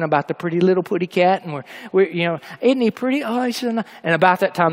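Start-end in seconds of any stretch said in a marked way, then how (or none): 2.6-3.8 high-pitched admiring coo; lightly acted sweet, high voice; imitated cooing. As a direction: coo over a pretty kitty. Cooing at the lion in story.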